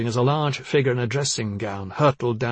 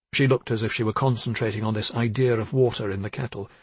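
plain reading voice

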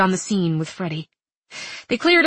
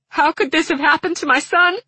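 high, louder, voice breaking into tears; 9 semitones higher, 3 dB louder than usual. A narrator's reading. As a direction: crack and cry out the question. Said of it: "She broke down again".

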